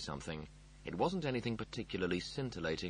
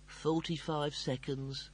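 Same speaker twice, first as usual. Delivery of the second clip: slow, higher-pitched moan; 5 semitones higher, slower than usual. A narrator's reading. Dying computer counting down, moaning. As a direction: moan it out slowly. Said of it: "Holly moaned".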